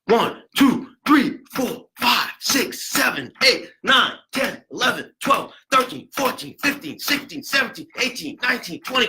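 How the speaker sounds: high, loud rhythmic count; punched numbers, driving pulse. Shown as a demonstration. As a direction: count compressions out to a steady beat. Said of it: "You gotta count out loud".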